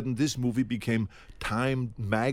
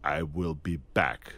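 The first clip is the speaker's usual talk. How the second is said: low, slow, deliberate I will be back with every word separate; machine line reading; 7 semitones lower, 4 dB quieter, slower than usual. Shown as a demonstration. Actor pitching his robotic take to the director, machine-like. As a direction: say it like an unfeeling machine. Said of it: "Why don't we say".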